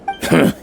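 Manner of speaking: one dry huff of a laugh; short mocking laugh. A tribesman scoffing at the flying squad with a short laugh. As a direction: scoff at the idea with a quick laugh.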